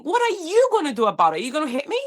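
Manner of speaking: high, loud dare; defiant challenge. Retold or imitated. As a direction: dare him to hit you. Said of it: "remember going to him and saying".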